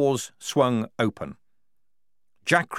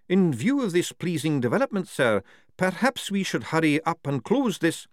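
lightly voiced general, higher; eager general; 8 semitones higher than usual. A narrator's reading. General urging Frock to finish, a touch higher. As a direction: nudge the commander to hurry.

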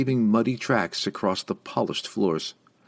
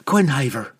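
higher, firm summons; calling out; 6 semitones higher, 4 dB louder than usual. A narrator's reading. Young woman rousing the panther to leave, calling out. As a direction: call the animal to attention. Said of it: "she called, rousing the panther".

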